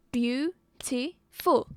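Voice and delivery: "beautiful" very slowly, BEAU, TI, FUL; syllable by syllable. Shown as a demonstration. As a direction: pause after each syllable of "beautiful". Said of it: "it has three syllables".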